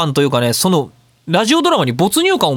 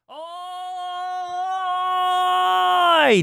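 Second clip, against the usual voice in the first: a long drawn-out hail from far across the room, well off the mic; distant call; 12 semitones higher, 6 dB quieter, much slower than usual. Shown as a demonstration. Showing listeners how distance alone makes a far-off call. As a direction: call out from far away, stretching the vowel as if across a field. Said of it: "ってやってるのって別にマイクの設定何にもいじってない".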